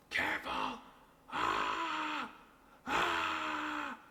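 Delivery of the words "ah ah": sharp high startled yelps. Real, not acted. Painter startled by a slip of the brush, sharp high yelps. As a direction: yelp in alarm.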